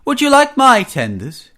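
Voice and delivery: high, lilting offer; loud, high-pitched false innocence; mock innocent. A narrator's reading. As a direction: offer it brightly, hiding a trick. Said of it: "he asked innocently".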